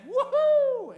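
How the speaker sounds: very high, loud whoop; gleeful whoop. Retold or imitated. Pigs whooping as they run off the cliff, very high and loud. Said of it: "They all went".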